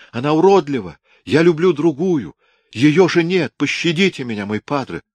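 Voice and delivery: high, loud begging; panicked son begging his father; desperate pleading. A narrator's reading. Son begs father, falling at feet.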